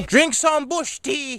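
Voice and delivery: put-on an old grandmother prescribing her cure; grandma voice. Grandma answers every complaint.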